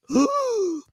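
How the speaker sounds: high, drawn gasp; shocked gasp. Retold or imitated. Telling friends how the news hit, mock shocked gasp.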